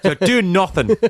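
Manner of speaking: bad put-on Irish accent. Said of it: "Terrible Irish accent there".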